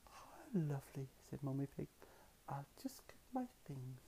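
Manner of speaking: hushed, unvoiced words; soft whisper. A narrator's reading. Mummy Pig answering the surprise softly. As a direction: murmur it gently.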